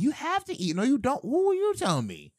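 high-pitched, indignant retort; mock indignation. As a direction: act out bristling at being told. Acting out retort to unwanted advice.

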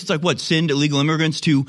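ordinary talk

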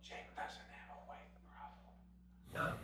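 slow, very hushed whisper; secretive mocking whisper; whispered aside. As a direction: whisper it confidentially, drawing it out.

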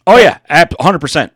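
ordinary delivery

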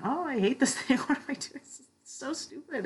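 high, half-laughing tearful wail; retold crying complaint. To friend Diane near the race's end, tearful high wail. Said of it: "just cried".

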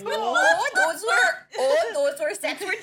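Midwestern aunt voice. Acted. Reader threatens to reread the scene as a Midwestern aunt, shrill and laughing. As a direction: squeal it like a gushing Midwestern aunt. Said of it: "When I become your Midwestern aunt".

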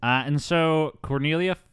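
ordinary talk

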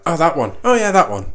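playful burst of recall; sudden recognition. Acted. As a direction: act out ideas suddenly popping up. Acting out sudden recall of examples.

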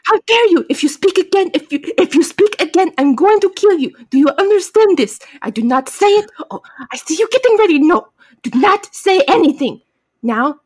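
high, loud, indignant fake accent; badly faked villain accent, worked up then easing; Kamun Ra. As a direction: scold and threaten in a hammed-up foreign accent. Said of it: "please forgive my horrible accent".

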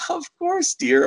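imitating a doting grandmother, pitched high; grandma voice. Retelling the sweet grandma at the counter, in a high voice. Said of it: "She's like".

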